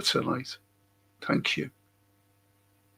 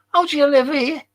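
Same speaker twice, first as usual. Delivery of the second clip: higher-pitched tourist's question; tourist in a joke; 11 semitones higher, 3 dB louder than usual. Retold or imitated. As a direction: ask it as the joke's tourist, pitched up. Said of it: "The tourist asked him".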